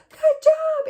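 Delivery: very high, loud good job; good job in a piercing high voice; high praise. Shown as a demonstration. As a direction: squeal the praise. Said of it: "is a very high frequency".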